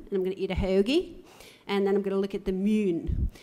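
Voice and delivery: quick, sing-song South Philly working-class accent; South Philadelphia accent. Shown as a demonstration. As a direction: rattle it off like a South Philly local. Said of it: "that region of Philadelphia is you say".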